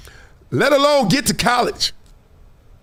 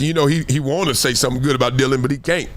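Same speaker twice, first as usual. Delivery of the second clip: usual speaking voice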